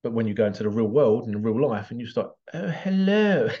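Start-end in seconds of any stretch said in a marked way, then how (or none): 2.5-3.5 high, exaggerated old-fashioned English accent; old-film English accent. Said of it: "most English people don't speak like that".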